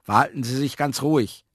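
a hint of official instruction; lightly voiced caretaker. A narrator's reading.

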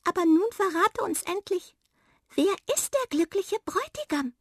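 high squeaky little mouse, curious; tiny mouse voice, eager and curious. A narrator's reading. Mouse asks about groom.

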